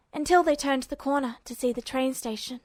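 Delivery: usual speaking voice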